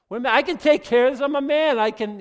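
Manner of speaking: loud, high and rushed boast; puffed-up, self-reliant bluster; cocky protest. Acted. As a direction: blurt it out as an overconfident man. Acting out overconfident self-reliant man.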